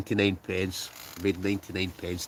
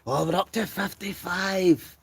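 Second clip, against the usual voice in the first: high, drawn-out cheer; excited announcement; 11 semitones higher, slower than usual. Real, not acted. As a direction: call it out high and slow, celebrating. Cheering the live chat's viewer count.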